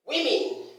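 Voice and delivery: WOMEN as WIM-in, high fall on the first syllable; women with short i in both syllables; correct pronunciation. Shown as a demonstration. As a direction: say women as wimmin. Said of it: "not women".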